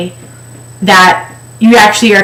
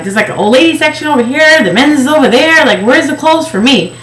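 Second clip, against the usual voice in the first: raised, quick exasperated complaint; half-mock frustration, a bit higher; 6 semitones higher than usual. Past the old lady section, the shopper in mock exasperation. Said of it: "I'm like".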